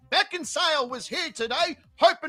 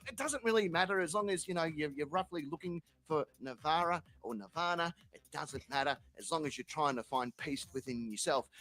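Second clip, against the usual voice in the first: much lower and softer, calm and gentle; soft soothing reply; 9 semitones lower, 9 dB quieter than usual. Pretend. Zen gardeners calming down the angry visitor, softly. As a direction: soothe them like a serene monk. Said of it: "And they'll be like".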